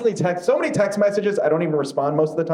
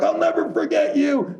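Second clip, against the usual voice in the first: heroic movie line; 12 semitones higher than usual. Retold or imitated. Parodying an action hero's farewell, hammed-up. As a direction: ham it up like a dying hero scene. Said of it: "he'll say something heroic like".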